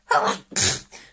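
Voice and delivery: real loud sneeze. Real, not acted. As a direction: let out a sudden sneeze. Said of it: "hence the sneezing".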